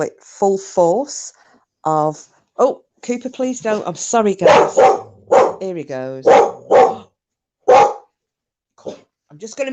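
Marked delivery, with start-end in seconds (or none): 2.6-3.9 high pleading plea to the dog. Real, not acted. Speaker scolding dog mid-story. 6.3-7.0 startled gasp. Real, not acted. Dog startles speaker.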